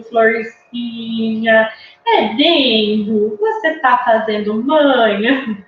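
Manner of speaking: slow, drawn-out cooing; talking to a baby girl. Acted. Adult to a crying baby in pink, slow coaxing baby talk. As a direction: stretch the words tenderly for a baby girl. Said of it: "vai alguém e fala assim".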